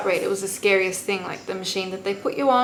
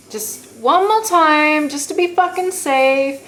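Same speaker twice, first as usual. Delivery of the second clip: pleading; 7 semitones higher, 8 dB louder, slower than usual. Retold or imitated. Begging staff to retest anaesthetic.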